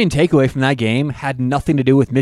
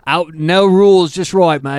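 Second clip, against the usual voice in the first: hammed-up Australian slogan voice, slow start; mock Australian accent; slower than usual. Host joking about loving Outback in a mock Australian accent. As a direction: put on a cartoon Australian accent.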